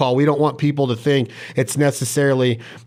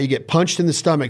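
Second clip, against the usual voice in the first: ordinary talk, nothing marked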